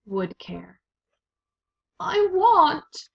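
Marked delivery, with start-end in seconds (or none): 2.0-3.1 high, loud cry of want; desperate high cry. A narrator's reading. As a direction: burst out in a high wail. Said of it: "cried Meg".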